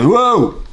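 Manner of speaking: high, rising "wow" sound; high wordless exclamation; loud wordless cry. Shown as a demonstration. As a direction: let out a big mute person's noise. Imitates mute person making sounds.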